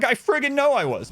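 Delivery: high, loud exasperated snap; exasperated retort. Retold or imitated. To the clerk, an exasperated high snap. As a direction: let the frustration burst out high. Retelling frustration with store clerk.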